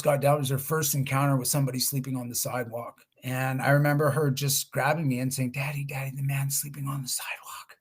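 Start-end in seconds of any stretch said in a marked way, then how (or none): 5.5-7.8 put-on soft whispered child's voice, tugging at dad; hushed little girl. Dad retelling his small daughter tugging at him, hushed whisper.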